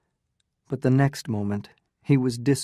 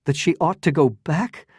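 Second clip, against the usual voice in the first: louder, higher, furious; violent cry; 5 semitones higher, 5 dB louder than usual. A narrator's reading. Newland rejects Medora's plea, crying out violently. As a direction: spit it out in outrage. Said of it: "cried the young man violently".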